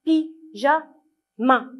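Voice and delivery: "Pyjama" very slowly as py, ja, ma; "Pyjama" broken up so ma stands alone; syllable by syllable. Shown as a demonstration. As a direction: separate "Pyjama" into three beats with clear pauses. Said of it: "on a entendu la syllabe ma".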